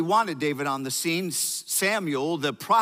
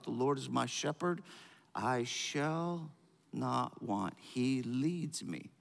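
soft reverent quote; 5 semitones lower, 9 dB quieter, slower than usual. Acted. Quoting the psalm reverently.

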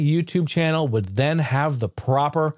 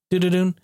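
slot machine tune; 5 semitones higher than usual. Retold or imitated. Imitating slot machine spin.

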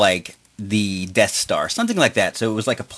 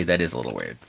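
much lower and softer, deadpan; low deadpan mutter; 11 semitones lower, 6 dB quieter, much faster than usual. Real, not acted. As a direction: drop the voice, dry aside. Uh, really.

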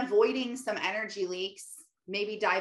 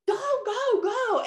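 high-pitched mock mom yell; imitated yell; 4 dB louder, slower than usual. Mom hurrying her daughter at school drop-off, imitated yell. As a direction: urge them on with a high retold holler. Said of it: "was, like, yelling".

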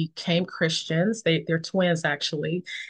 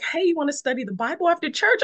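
eager invitation; 10 semitones higher, much faster than usual. Retold or imitated. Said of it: "She was like".

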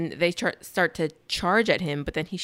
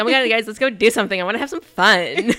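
mock eager friend; 3 semitones higher, 7 dB louder than usual. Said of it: "You'd be like".

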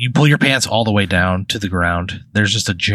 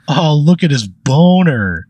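higher, slow, louder jeer from a classmate; mocking classmate; 6 semitones higher, 4 dB louder, slower than usual. Classmate at the back of the class jeers, slow and mocking. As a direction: drag out the taunt like a mean kid.

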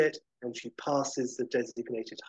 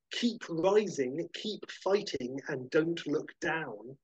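trailer tagline read; 4 semitones higher, slower than usual. Pretend. Reading movie tagline.

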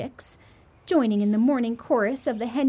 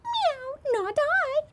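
much higher meowing cat voice; a cat refusing with a high meow; much slower than usual. A narrator's reading. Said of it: "with a meow".

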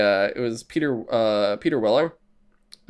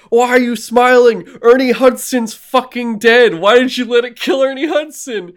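high, very loud, hammed-up indignation; mock outraged yelling; 11 semitones higher, 11 dB louder than usual. Retelling a viewer upset that Ernie Hudson died, mock outrage. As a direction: yell in disbelief at the screen.

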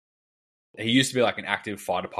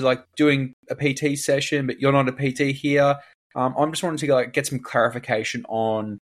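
mock prim manager; 4 semitones higher than usual. Imitating team leader's complaint.

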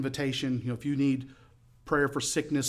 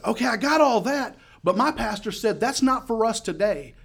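high, raised voice over noise; 9 semitones higher, 5 dB louder than usual. Retold or imitated. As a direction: argue back over loud music. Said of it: "I'm having to yell".

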